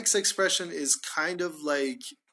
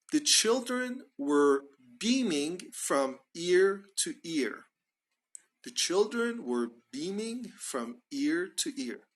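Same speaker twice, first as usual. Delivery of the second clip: slow example sentence; 3 semitones higher, slower than usual. Shown as a demonstration. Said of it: "So we can say, for example".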